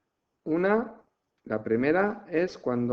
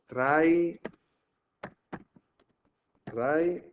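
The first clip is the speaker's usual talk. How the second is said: syllable split; 4 semitones higher than usual. Shown as a demonstration. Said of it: "guión y".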